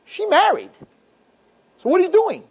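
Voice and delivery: hammed-up amazement; incredulous. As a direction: pitch jumps up in mock disbelief. Mock shock at the married woman.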